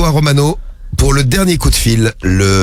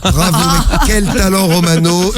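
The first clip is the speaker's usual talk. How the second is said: slow, high, laughing bravo; laughing praise; 6 semitones higher, slower than usual. Real, not acted. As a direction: cheer him on through a laugh. Host praises prank with laughter.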